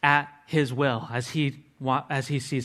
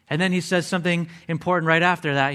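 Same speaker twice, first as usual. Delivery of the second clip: ordinary talk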